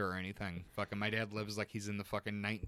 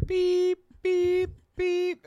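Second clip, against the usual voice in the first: high steady electronic beeps; reversing truck beeps; 11 dB louder, much slower than usual. Shown as a demonstration. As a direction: imitate a truck backing up. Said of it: "that's a truck backing up noise".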